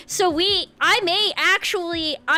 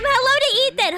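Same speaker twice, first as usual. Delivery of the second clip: usual speaking voice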